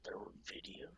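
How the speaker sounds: hushed, breathy question; whispered surprise. Real, not acted. Co-host surprised there were videos, startled whisper.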